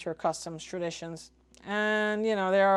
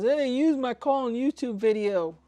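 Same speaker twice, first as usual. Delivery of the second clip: lightly voiced indignant objector, higher pitch; complaining viewer; 5 semitones higher, 4 dB louder than usual. Someone protesting a call used on YouTube, mock complaint. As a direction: raise it slightly like a mock objection. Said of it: "so that nobody says".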